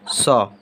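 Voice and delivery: सौ said as a rounded "sau"; sounding out a letter. Shown as a demonstration. Teacher showing pupils how से sounds. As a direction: model the sound सौ. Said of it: "इसका उच्चारण हो जाएगा".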